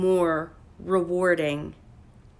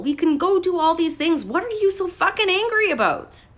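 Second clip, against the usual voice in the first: high, loud exasperated rant at the narcissist; exasperated retold rant; 9 semitones higher, 4 dB louder than usual. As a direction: throw the frustration back at the partner, rising to a yell. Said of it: "look at the narcissist and go".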